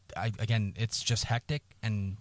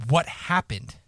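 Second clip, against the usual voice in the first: raised, louder disbelieving question; retold shocked demand; 5 semitones higher, 4 dB louder, slower than usual. Retelling his reaction to mom at news of uncle's death, shocked demand.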